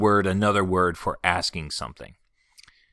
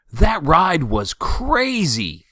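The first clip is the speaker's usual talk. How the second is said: higher, slower, thrilled after a ride; excited exclamation; 8 semitones higher, 5 dB louder, much slower than usual. Shown as a demonstration. Teacher showing an exclamation, excited. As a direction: gush about a wild ride. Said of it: "So here are some examples".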